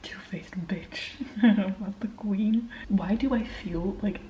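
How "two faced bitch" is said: slow, soft whispered scorn; whispered insult. Real, not acted. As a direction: spit it under your breath. Insults character under breath.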